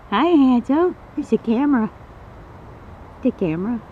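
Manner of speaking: high, sweet cooing greeting. Real, not acted. Owner greeting her snake Hansel, high sing-song coo.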